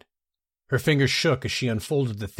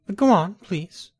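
sudden bright urging; 9 semitones higher, 5 dB louder, slower than usual. Acted. Baron urging the king onward.

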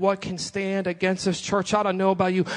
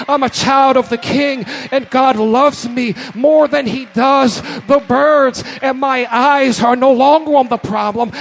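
loud, fervent preaching to the church; full-force preaching; 4 semitones higher, 6 dB louder than usual. Acted. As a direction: preach it hard and drive every line home.

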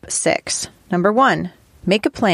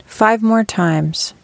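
guided meditation. Acted. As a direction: guide them gently into stillness, phrase by phrase.

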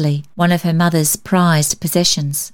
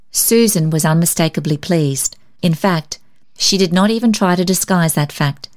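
usual speaking voice

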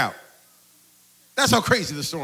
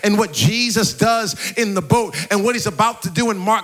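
impassioned preaching; 5 semitones higher, 4 dB louder than usual. Real, not acted. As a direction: preach it with rising fervour, higher and louder towards the end. Preacher builds to full force.

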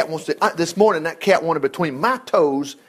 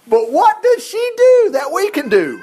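impassioned plea; 11 semitones higher, 4 dB louder than usual. Real, not acted. Impassioned sermon question.

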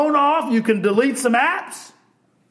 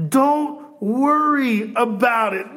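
slow mock repeat; 4 semitones higher, slower than usual. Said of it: "Can you say that again?".

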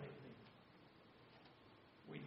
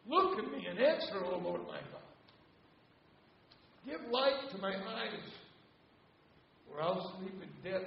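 1 psalm read as lament; 5 semitones higher, 11 dB louder than usual. Acted. Reads Psalm thirteen as a cry.

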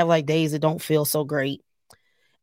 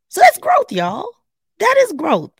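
high, loud proud exclamation; proud cheer; 7 dB louder than usual. Real, not acted. Vlogger cheering her own growth, high and loud. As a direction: celebrate yourself out loud.

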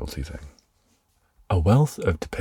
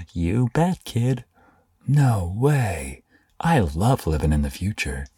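put-on high, cheesy ad host and kid; infomercial voice; 7 semitones higher, 3 dB louder than usual. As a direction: ham it up like an old infomercial. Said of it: "the infomercial type things, the educational videos".

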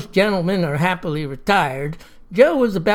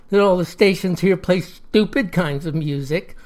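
usual speaking voice

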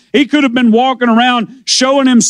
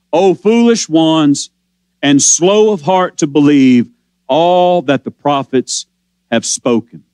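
slow and low, weighing every word of the rebuke; slow, low scripture reading of a rebuke; 7 semitones lower than usual. Acted. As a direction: let each phrase land slowly, low and grave. Preacher voicing Jesus' rebuke.

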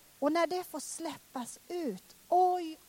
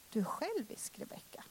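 God whispering; 8 dB quieter than usual. Retold or imitated. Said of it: "Gud bara viskar inom mig".

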